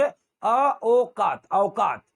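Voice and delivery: औकात slowly as "a... o... kaat", then joined; slow, loud build-up to "a-okaat" for औकात; broken-down vowel demo. Shown as a demonstration. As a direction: split औकात into "a" plus "o", then blend. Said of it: "क्या बोलेंगे".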